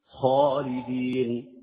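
slow, chanted خالدين with long vowels; drawn-out tajweed chant on خالدين; recitation demo. Shown as a demonstration. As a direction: recite خالدين slowly, sounding the throat خ. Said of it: "الغين والخاء".

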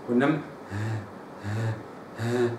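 ها from the top of the throat, low and soft; correct ha. Shown as a demonstration. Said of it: "لو قلت ها ... وإنما ها". (ها من أعلى الحلق)

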